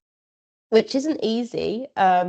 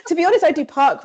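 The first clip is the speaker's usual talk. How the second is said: fast, high, through laughter; giggling confession; laughing; 10 semitones higher, 5 dB louder, faster than usual. Real, not acted. Laughing admission.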